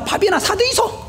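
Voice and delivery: 드이소 said with a high Gyeongsang dialect lilt; the deacon's kind offer in a high Gyeongsang dialect voice. Retold or imitated. Deacon telling the student to buy a meal, Gyeongsang dialect. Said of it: "경상도 사투리로".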